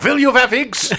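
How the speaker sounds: high, laughing German reporter voice; a German accent with vee for will; mock German accent. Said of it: "He's like".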